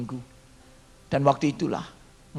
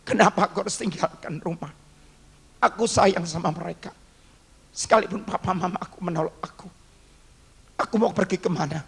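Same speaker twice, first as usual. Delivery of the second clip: tearful prayer; 3 semitones higher than usual. Retold or imitated. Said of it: "dan saya menangis".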